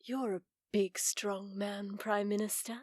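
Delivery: soft flattery. Acted. Soothing the prime minister.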